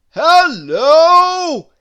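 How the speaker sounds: high, very loud street hello; big drawn-out shout; shouted greeting. A narrator's reading. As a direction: bellow a greeting at full volume. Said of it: "they'd shout as they met".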